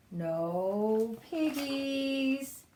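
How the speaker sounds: very slow, drawn-out duck voice. Acted. Disguised pig playing duck, slow and odd. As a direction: stretch it out like a stubborn duck.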